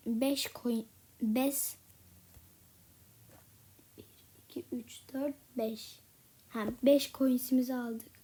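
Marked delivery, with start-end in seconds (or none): 4.0-6.0 barely audible counting to yourself; soft whispered counting to five. Real, not acted. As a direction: whisper the count under your breath. Counting coins under breath.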